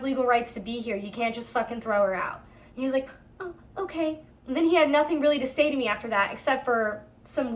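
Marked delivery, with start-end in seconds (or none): 3.4-4.2 small, higher, softer mock reply; meek acceptance. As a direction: imitate him backing down meekly. Cops' answer deflates the man.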